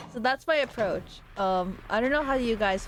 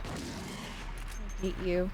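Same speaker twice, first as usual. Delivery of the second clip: muttering; 2 semitones lower, 8 dB quieter, much slower than usual. Real, not acted. Muttering at an enemy.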